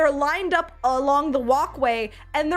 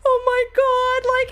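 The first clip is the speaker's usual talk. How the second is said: slow, high and loud overwhelmed gasp; drawn-out overwhelmed "oh my God"; stunned emotional oh my God; 10 semitones higher, 6 dB louder, much slower than usual. Retold or imitated. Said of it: "I'm like".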